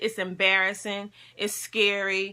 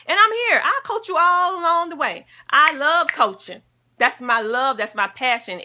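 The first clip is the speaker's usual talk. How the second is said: much higher and louder, bursting with eagerness; eager coach; 7 semitones higher, 6 dB louder than usual. Real, not acted. Coach to viewers, eagerly offering to help. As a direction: sell it with joy.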